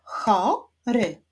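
"Chory" as CHO... RY, two separate beats; "Chory" split, rising cho then falling ry; syllable by syllable. Shown as a demonstration. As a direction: clap out "Chory" with a pause after cho. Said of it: "wyklaszczmy sylaby w wyrazie chory".

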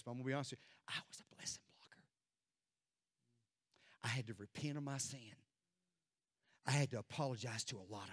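low, soft preacher's confession; subdued testimony, nearly whispered in places; hushed confession. Real, not acted.

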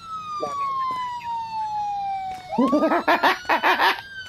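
squealing laugh; high-pitched giggle. Real, not acted.

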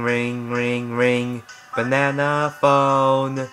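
normal talking voice

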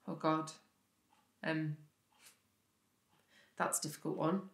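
low, hushed, hesitant reply; troubled. Acted. Speaker faces a painful question from James, troubled and quiet.

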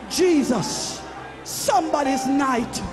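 ordinary talk

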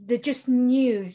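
usual speaking voice

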